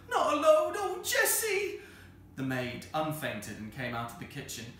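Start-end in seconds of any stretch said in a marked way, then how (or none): 0.1-1.8 very high, loud wail, drawn out; frantic cry. Acted. Vicar's wife calls the maid for help, frantic shriek.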